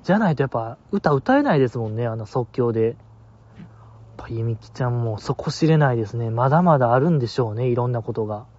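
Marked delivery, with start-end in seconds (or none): 2.9-6.3 whisper. Real, not acted. Awed aside about Yumiki.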